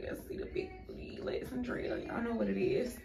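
whispered quick aside; breathy hushed whisper. Real, not acted. Whispered aside about her outfit.

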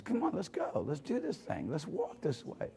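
hushed, soft beckoning; the Lord calling. Acted. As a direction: coax them gently to come along, quietly. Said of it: "the Lord's always calling".

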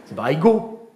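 mock the stiff French "I go"; French accent. Shown as a demonstration. Mocking how French pupils say I go. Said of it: "En français, t'es là".

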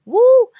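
loud high whoop of joy; real excited whoop; cheer. Real, not acted. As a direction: let out a big happy whoo. Cheering about being happy.

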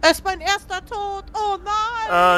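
panicking gamer. Real, not acted. Character just died.

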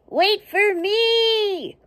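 high, slow pleading cry; penguin calling out. Acted. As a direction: call out like a penguin left behind. Penguin left behind calling.

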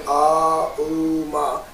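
slow syllables. Shown as a demonstration. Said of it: "Instead of saying slowly".

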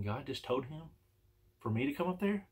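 softly puzzled, rising into a question; incredulous question. Retold or imitated. His puzzled thought on hearing it.

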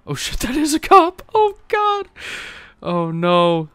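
high, loud cry of dismay; alarmed. Real, not acted. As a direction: blurt it out in rising panic. Realises he hit a cop.

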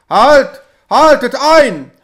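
very loud, high angry yell; furious shout. A narrator's reading. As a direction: stop them dead from above. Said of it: "schrie er zu den Karren hinunter". (wütender Schrei)

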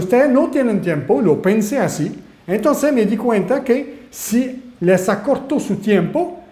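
normal talking voice

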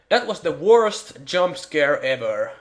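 slow, drawn-out mock verdict; mock declaration. Player mocking the lame jumpscare, slow verdict. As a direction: pronounce it like a solemn judgment.

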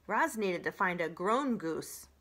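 usual speaking voice

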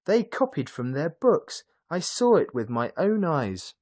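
put-on high-pitched indignant boy; schoolboy's outraged accusation, high-pitched; defiant cry. A narrator's reading. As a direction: insist hotly, voice way up. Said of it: "Emil cried".

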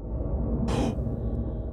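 breathy scared gasp; hushed frightened gasp. Real, not acted. Monster appears suddenly.

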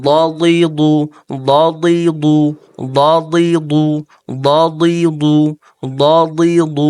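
drilled recitation; dad from the right side. Shown as a demonstration. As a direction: press the right edge of the tongue for ضليض. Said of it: "ডান দিক দিয়ে উচ্চারণ করতে হলে".

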